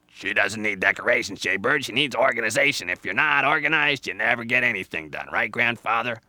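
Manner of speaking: loud, insistent animal character; bossy Squirrel. Acted. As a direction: lecture busily like a know-it-all squirrel. Squirrel argues for organization.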